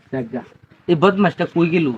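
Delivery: normal talking voice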